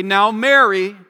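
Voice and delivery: loud, firm challenge by name; exhorting. Retold or imitated. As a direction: admonish her with weight on the name. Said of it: "having to exhort her or challenge her".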